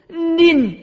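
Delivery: high-pitched start of a curse; mock cursing. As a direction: launch into a swear word and cut it off. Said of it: "伊骂啦". (假作欲骂人)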